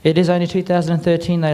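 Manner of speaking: normal talking voice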